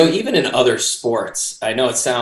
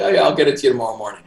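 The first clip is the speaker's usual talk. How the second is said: fast quoted reply; much faster than usual. Retold or imitated. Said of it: "He's like".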